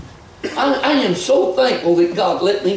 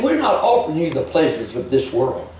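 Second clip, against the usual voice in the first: ordinary talk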